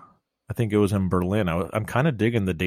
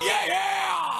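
mock rock-star crowd cry. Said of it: "That was perfect".

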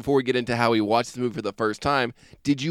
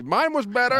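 mock brag; 10 semitones higher than usual. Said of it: "so I'll be like".

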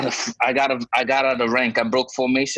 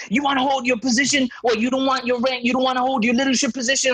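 high, fast scolding threat; hammed-up high-pitched sergeant; mock angry sergeant; 9 semitones higher than usual. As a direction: rattle off the threat like a petty boss. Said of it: "he's like".

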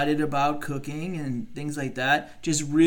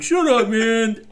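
annoyed friend; 8 semitones higher, 7 dB louder, slower than usual. Retold or imitated. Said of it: "He's like".

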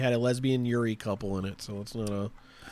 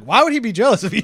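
incredulous question; 6 semitones higher, 6 dB louder, faster than usual. Retold or imitated. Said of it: "and Sam's like".

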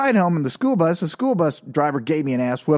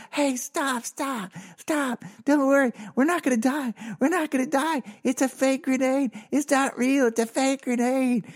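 acted high, squeezed calling; high voice, insisting it's all harmless; high-pitched pleading reassurance; 9 semitones higher than usual. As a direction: imitate trying to halt a panicked crowd. Acts himself calming panicked classmates.

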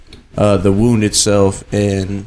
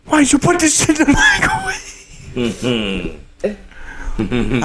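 high, fast then slowing rant; mock angry husband; 10 semitones higher than usual. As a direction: play the fed-up husband scolding. Acted husband-wife quarrel.

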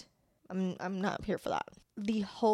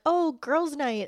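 mock sweet girls' night; 6 semitones higher, 4 dB louder, slower than usual. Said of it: "not like".

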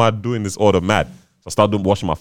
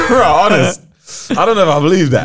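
laughing; 9 semitones higher, 4 dB louder than usual. Real, not acted. Teasing disbelief with laughter.

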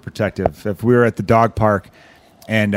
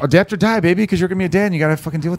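higher-pitched, fast hype cadence; weird cadence; 5 semitones higher, faster than usual. Real, not acted. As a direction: rattle it off in an odd, high cadence. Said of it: "my voice got all fucking weird with this kinda cadence".

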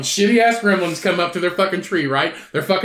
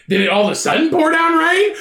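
normal talking voice

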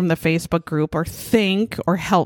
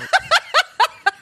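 high-pitched laugh. Real, not acted. Laughing at ether bunny post.

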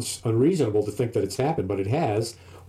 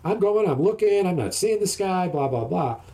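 raised voice, quick and urgent; daughter's voice; 7 semitones higher than usual. Retold or imitated. Daughter telling dad something's fishy, pitched up. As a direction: play the worried daughter, pitched up. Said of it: "And she's".

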